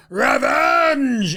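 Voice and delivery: high, drawn-out theatrical cry; hammed-up battle cry. Host acts out expected comeback, hammed-up cry. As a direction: stretch it out as a mock war cry.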